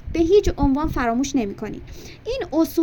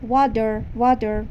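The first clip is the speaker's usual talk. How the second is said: American accent; 3 semitones lower, much slower than usual. Shown as a demonstration. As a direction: say "water" with an American flapped T, "wah-der". («water» با لهجهٔ آمریکایی)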